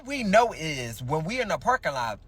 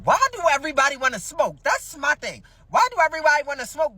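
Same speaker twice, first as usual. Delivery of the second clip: exasperated; 10 semitones higher, 3 dB louder than usual. Acted. Exasperated rhetorical rant.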